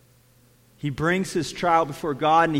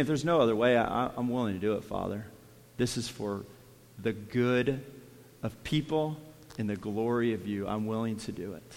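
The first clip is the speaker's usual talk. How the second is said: quiet surrender; 6 semitones lower, 8 dB quieter than usual. Acted. Jesus yielding to the Father.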